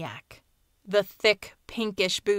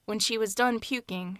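ordinary delivery, nothing marked

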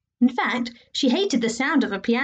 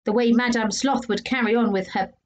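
ordinary delivery

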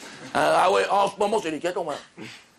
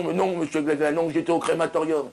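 normal talking voice

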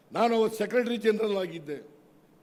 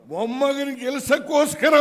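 rally shout; 6 semitones higher, 5 dB louder than usual. Real, not acted. Rally speaker attacking rival.

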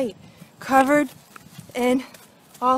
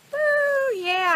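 high whooping cheer of joy; excited whoop; 12 semitones higher than usual. Real, not acted. Angler cheering the first fish, excited whoop. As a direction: whoop it up over the catch.

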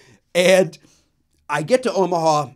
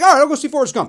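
mock exasperated agreement; 13 semitones higher, 9 dB louder, much faster than usual. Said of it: "I'm like".

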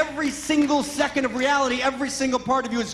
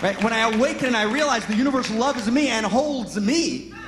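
ordinary talk

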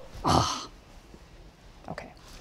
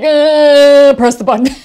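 much louder, forcing the decision out; psyching herself up; 7 semitones higher, 12 dB louder, slower than usual. Retold or imitated. Marla at the studio gate forcing herself, loud self-push. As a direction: push yourself through the fear. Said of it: "She's like".

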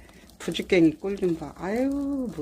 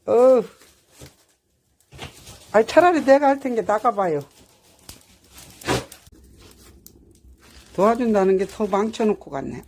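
louder, higher, fed-up telling off; annoyed loud scolding; 3 semitones higher, 10 dB louder than usual. Real, not acted. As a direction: tell him off for making it worse. Sends husband away, annoyed. (남편에게 짜증 내며 크게 나무라듯)

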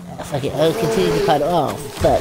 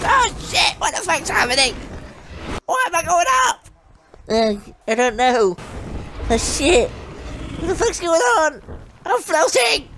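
baffled alarm; 7 semitones higher than usual. Real, not acted. Character floating up strangely.

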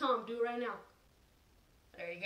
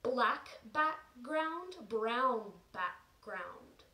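slow and careful; much slower than usual. Shown as a demonstration. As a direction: say "brown background" slowly and clearly. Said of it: "Even I said it wrong there".

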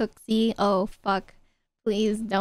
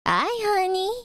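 high sugary greeting to a sweetheart; cutesy baby voice; 8 semitones higher than usual. Acted. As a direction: coo it like a baby. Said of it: "how to say it cutely".

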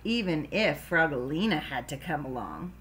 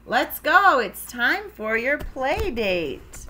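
raised, slowed calling voice; 5 semitones higher, 4 dB louder, slower than usual. Acted. Dad tells Froggy it's play date time, calling out. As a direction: summon the child to get moving.

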